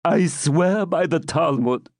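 put-on high, beseeching old man; pleading old man. Captive pleading for his friends, beseeching. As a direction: beg for your companions. Isaac protests.

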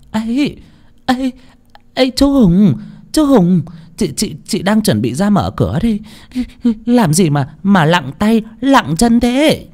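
high, flustered woman's voice; flustered wife. A narrator's reading. Wife nervously greeting the debt collector, high and flustered. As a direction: nervously stall the visitor at the door.

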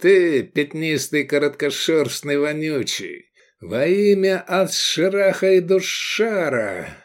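slow, high insults building to an oath; seething invocation. A narrator's reading. Swordsman curses the zealot before striking, seething. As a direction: draw out each insult, then raise the oath. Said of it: "цедил он".

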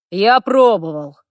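slow, loud boast; proud claim. A narrator's reading. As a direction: declare it proudly, drawn out. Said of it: "гордо заявил Харт". (гордое заявление)

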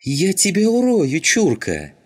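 high, loud enraged outburst; furious threat. A narrator's reading. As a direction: scream a threat at your enemy. Skinhead threatens sailor in quarrel. (яростная угроза, срываясь на крик)

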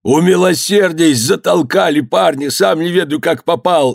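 high, frantic pleading; crying out. A narrator's reading. Cook begging master for mercy, high pleading cry. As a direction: protest innocence in a high wail. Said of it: "кричал повар".

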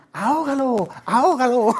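playful ant chorus shouting. Retold or imitated. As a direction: ham up the ants crying out. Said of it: "y las demás gritaban".

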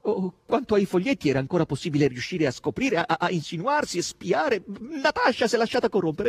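rising, loud, high alarm; flustered, high and loud; agitated. A narrator's reading.

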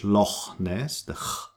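Loch ending in a back-of-throat rasp; throaty ch. Shown as a demonstration. Teacher likens German CH to Scottish, throaty ch. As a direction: scrape the ch of Loch, then ch alone. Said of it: "like K H in Scottish".

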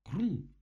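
громкий, высоко взлетающий «хрум»; выкрикни хруст высоко и во всю силу; очень громкий хруст. A narrator's reading. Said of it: "Гораздо громче, чем прежде". (оглушительный высокий хрум)

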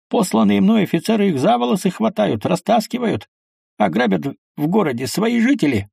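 high, mild-mannered general; soft voice. A narrator's reading. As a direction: plead gently in a high-pitched voice. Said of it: "мягким голосом сказал". (мягким, высоким голосом)

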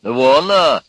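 loud, high outraged exclamation; furious. A narrator's reading. Electrician enraged at Urka's story, furious. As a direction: blow up at him. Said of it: "вконец обозлился электрик".

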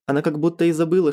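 ordinary delivery, nothing marked

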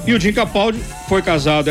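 ordinary talk

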